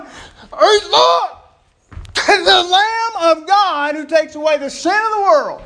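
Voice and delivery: loud, high, laughing yell of discovery; excited scream announcing the Lamb of God; urgent shout. Retold or imitated. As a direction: cry it out with full urgency. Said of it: "He's going".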